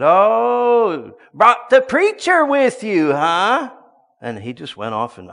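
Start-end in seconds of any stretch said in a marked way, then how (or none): 0.0-3.7 loud, high, drawn-out mock yell; mocking holler. Foul man yelling at the pastor from the hall, mocking holler. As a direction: holler it tauntingly, long and high.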